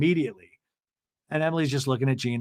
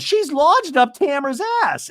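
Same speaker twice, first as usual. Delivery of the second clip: much higher, slow, snide; catty Gina impression; 12 semitones higher, 4 dB louder than usual. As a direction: savor the insult.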